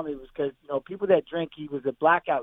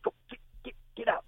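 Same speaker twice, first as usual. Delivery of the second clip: hushed, urgent whisper; a wife's quick whispered push to leave; retold whisper; 7 semitones higher, 10 dB quieter, faster than usual. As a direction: whisper it fast so the line won't hear. Wife urging him out of line.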